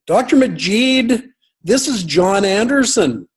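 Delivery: high-pitched, exclaimed greeting; excited recognition. Retold or imitated. Caller recognising an old acquaintance on the phone, excited.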